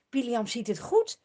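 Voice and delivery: usual speaking voice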